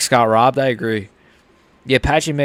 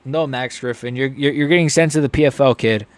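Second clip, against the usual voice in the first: ordinary talk, nothing marked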